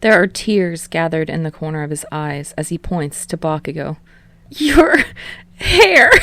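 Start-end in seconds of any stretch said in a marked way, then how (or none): 4.5-6.1 high, loud laughing cry; shrieking laughter. A narrator's reading. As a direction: crack up pointing at him. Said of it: "laughs uproariously".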